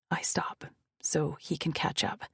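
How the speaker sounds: ordinary delivery, nothing marked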